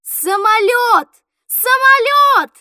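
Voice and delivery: high, loud, stretched calls; loud drawn-out cry at a sudden sighting; excited shout. A narrator's reading. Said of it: "и закричал".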